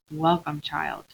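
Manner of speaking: lightly voiced Aslan, softly greeting Lucy; gentle lion. Aslan voiced low and soft.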